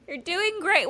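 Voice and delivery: high, laughing mock-encouragement; Kris Jenner. Podcast host playing the proud momager, high and laughing. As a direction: cheer them on in a high put-on voice. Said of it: "we're really just Kris Jenner here".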